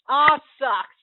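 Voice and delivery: loud offhand groan of a passer-by; hammed-up annoyed yelp; mock exclamation. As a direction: blurt it out. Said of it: "and someone says".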